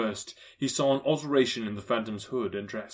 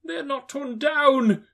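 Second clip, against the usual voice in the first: high, louder, slow joy; Scrooge crying out in relief; 13 semitones higher, 7 dB louder, slower than usual. A narrator's reading. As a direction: marvel at the bed curtains. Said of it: "cried Scrooge".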